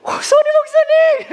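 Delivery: hammed-up, very high and loud delighted cry; overjoyed greeting. Imitating newcomers thrilled to see the pastor, hammed-up joy. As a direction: shriek a greeting in mock delight, drawn out. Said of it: "얼마나 반가워하시는지".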